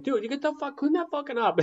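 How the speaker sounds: FBI agent. Retold or imitated. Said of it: "The FBI agent".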